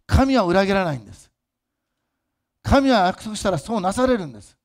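loud, high preacher's cry; fervent preaching. Acted. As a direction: proclaim it to the whole hall. Preaching at full force.

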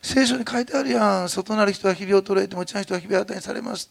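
upbeat Osaka mom reminding her son of the Bible; mother in Osaka dialect. Retold or imitated. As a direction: lift him up with Kansai brightness. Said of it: "とか言ってね、全然明るいの".

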